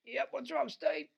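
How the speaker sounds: high, loud holler across the bush; calling out. Retold or imitated. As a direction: imitate a mate shouting back from afar. Mate answering whistle from the bush.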